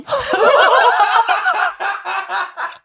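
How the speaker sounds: high, loud, long laugh; burst of laughter. Real, not acted. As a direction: crack up helplessly. Laughing at a silly translation.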